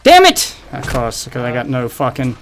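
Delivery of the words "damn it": high, loud angry shout; real rage at dying; frustrated shout. Real, not acted. Real gaming frustration outburst.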